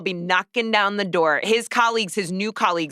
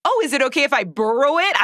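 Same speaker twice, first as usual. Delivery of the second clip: put-on Canadian accent; 6 semitones higher, 3 dB louder, faster than usual. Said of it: "that Canadian accent is gonna come through".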